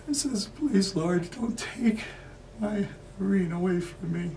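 high, anguished, tearful prayer; desperate pleading. Acted. Man begging the Lord not to take Irene, desperate. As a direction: beg in rising grief. Begs God to spare Irene.

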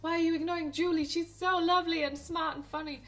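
high, loud and fast mock protest; exasperated viewer. Viewer scolding Lady Bird over Julie, high fast mock outrage. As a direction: scold her in a rush, voice jumping up. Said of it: "but then you're like".

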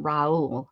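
"Raul" as "rah-OOL", falling; two-syllable Raul. Shown as a demonstration. Streamer settling on the last version, "Raul" as "rah-OOL". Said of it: "Oh, the last one".

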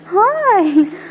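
very high, loud squealing hello; pet voice. Real, not acted. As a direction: squeal with delight at the dog. Greeting excited dog.

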